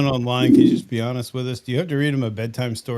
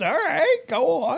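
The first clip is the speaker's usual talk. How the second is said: pitched way up, mock intrigued; teasing; slower than usual. Real, not acted. Friend egging on the Penny talk, high and teasing. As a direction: egg them on playfully.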